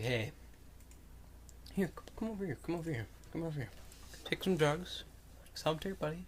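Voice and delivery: hushed, coaxing mock sales pitch; furtive offer. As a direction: softly imitate a shady street dealer. Acting out a furtive drug-offering veteran.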